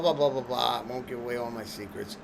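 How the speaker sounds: ordinary talk, nothing marked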